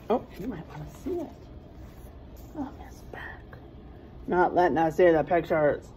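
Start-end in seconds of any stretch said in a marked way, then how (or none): 0.1-3.6 hushed aside; soft unvoiced whisper. Real, not acted. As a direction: whisper it to a colleague. Aside to colleague.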